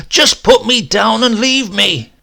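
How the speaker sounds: high, loud, slow desperate plea; pained shout. Acted. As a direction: beg them to stop through the pain. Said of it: "I wanted to shout".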